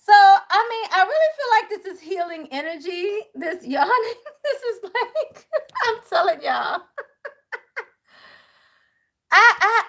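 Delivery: high teary laughing voice. Real, not acted. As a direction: laugh through the words. Laughing teary while explaining.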